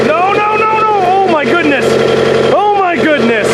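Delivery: high alarmed no no no, then oh my goodness; real panic as the engine slips, voice shooting up; panicked cry. Real, not acted. Watching the engine slip off the lift, panicked high cries.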